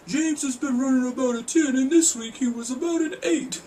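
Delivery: high-pitched put-on quoting voice; Jimmy Iovine. Vlogger mocking a judge's grading system, high caricature voice. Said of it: "We know how Jimmy Iovine's grading system worked. He said".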